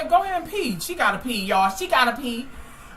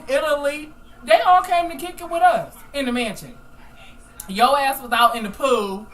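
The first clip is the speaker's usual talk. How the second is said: normal talking voice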